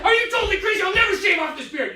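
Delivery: furious high shout; enraged shout. Retold or imitated. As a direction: scream it at your friend, outraged. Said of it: "I'm totally enraged and stuff".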